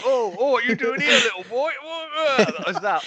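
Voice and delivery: high, loud hammed-up Cockney; mock an American's bad London accent; over-the-top mock London accent, loud and high-pitched; fake London accent. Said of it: "I've got the fakest accents".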